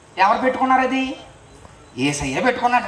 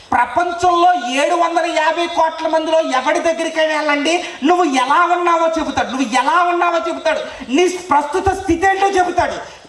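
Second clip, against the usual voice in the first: fiery preaching; 7 semitones higher than usual. Acted. Preacher marvelling to the crowd at Peter's tears, fiery. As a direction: proclaim the wonder with full force.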